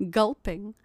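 usual speaking voice

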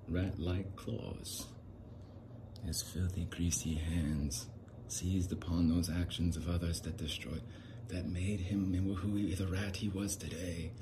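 slow, deliberate gothic narrator; dramatic story narration. Acted. Telling the tale of a rat-like man, slow dramatic narration. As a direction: relish each phrase with long pauses.

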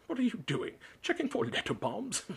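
high-pitched, loud taunting call; shouted jibe. A narrator's reading. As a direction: call out a joke at the boy's expense. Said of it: "shouted Uncle Vernon from the kitchen".

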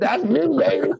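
very high, gleeful retold brag; excited boast. Guest retelling hearing his radio ad, high excited boast. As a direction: show off with a high rising pitch.

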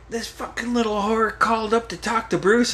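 high mock-indignant mom voice; pissed-off mom. Mom complaining to the family about a girl calling, pissed off. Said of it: "over the top, pissed off".

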